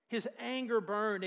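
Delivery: ordinary talk, nothing marked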